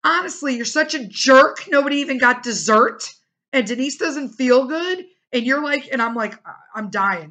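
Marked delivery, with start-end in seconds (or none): 0.0-5.0 higher and louder scolding; imitating the sister's angry scolding; sister telling off. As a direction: give him a proper telling-off. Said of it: "she's like".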